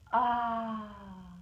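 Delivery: long falling "ah" sigh; drawn-out "ah". Shown as a demonstration. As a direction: model a long, open "ah". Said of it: "Do it with me".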